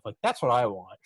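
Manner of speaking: normal talking voice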